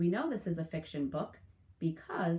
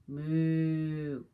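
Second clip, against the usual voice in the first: cow moo; 6 dB louder than usual. Acted. Cows typing refrain.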